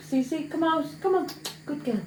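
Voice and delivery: high, soft coaxing voice to a pet; coaxing the dog. Real, not acted. Owner sweet-talks her dog to come out, high coaxing voice. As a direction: coax the dog out sweetly, fast.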